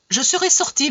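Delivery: high-pitched indignant retort; exclaiming. A narrator's reading. Father retorting to the unwanted suitor, exclaiming. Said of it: "m'écriai-je".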